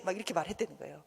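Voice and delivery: normal talking voice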